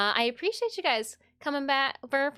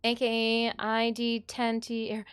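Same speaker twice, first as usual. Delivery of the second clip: ordinary delivery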